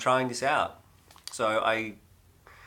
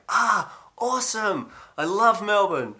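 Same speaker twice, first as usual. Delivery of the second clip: much higher and louder, gushing; butter them up with over-the-top delight; faking excitement to win over a Melbourne local; fake enthusiasm; 11 semitones higher, 5 dB louder, slower than usual. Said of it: "Full Jedi mind trick".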